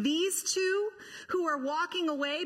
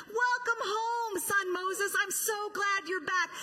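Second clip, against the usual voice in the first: mock welcome; 6 semitones higher than usual. Mock Pharaoh welcoming Moses.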